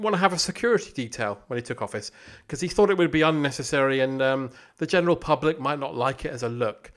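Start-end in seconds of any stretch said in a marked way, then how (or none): none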